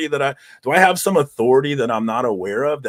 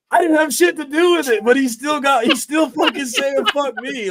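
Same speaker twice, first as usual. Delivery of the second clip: angry shout; 12 semitones higher, 4 dB louder than usual. Real, not acted. Indignant rant about the hater.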